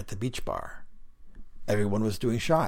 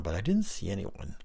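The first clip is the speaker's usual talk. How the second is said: ordinary delivery, nothing marked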